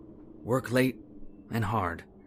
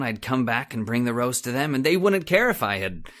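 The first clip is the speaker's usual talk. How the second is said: ordinary talk, nothing marked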